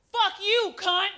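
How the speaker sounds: high-pitched angry retort; mad patient. Retold or imitated. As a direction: imitate a patient cursing someone out. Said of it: "she got mad at me and she said".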